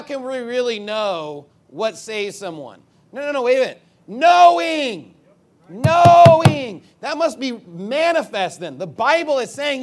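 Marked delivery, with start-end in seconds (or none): 4.1-6.8 Knowing boomed high and drawn out; emphatic Knowing. Shown as a demonstration. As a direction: thunder out each Knowing as a long, loud proclamation. Repeats verse's word to hammer it.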